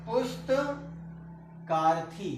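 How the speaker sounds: पुस्तकार्थी stretched slowly, each syllable held; slow drawn out. Shown as a demonstration. Teacher spelling the joined word for students, slow and drawn out. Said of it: "teacher sounds out the joined word".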